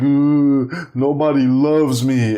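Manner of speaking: put-on weepy child's complaint; imitating crying child. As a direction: whine it slowly like a sobbing little boy.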